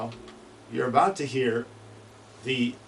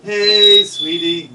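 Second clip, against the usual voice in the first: high whistled hey sweetie imitation; chickadee call; 9 semitones higher, 15 dB louder, much slower than usual. Shown as a demonstration.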